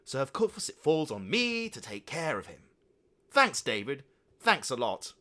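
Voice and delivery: furious mother. A narrator's reading. Mother furiously scolding the father. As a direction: tear into him. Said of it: "was furious with Father David".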